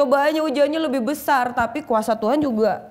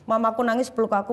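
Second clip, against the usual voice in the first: usual speaking voice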